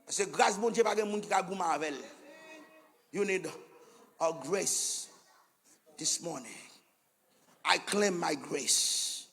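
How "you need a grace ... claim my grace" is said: much softer and lower, very slow; an intimate sermon murmur; hushed slow appeal. Acted. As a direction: draw them in with a near hush.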